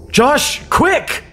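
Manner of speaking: high, loud panicked yell; alarmed yell as something nears him. Acted. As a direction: yell at him to move now. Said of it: "I yelled".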